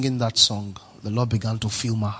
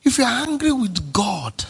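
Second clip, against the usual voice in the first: pleading cry; 9 semitones higher than usual. Real, not acted. Preacher pleading, voice cracking.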